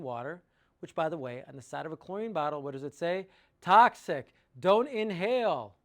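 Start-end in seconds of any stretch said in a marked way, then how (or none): 3.6-5.8 loud, slowed label reading; emphatic warning. Acted. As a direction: boom it out as a stark warning.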